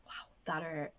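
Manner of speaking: usual speaking voice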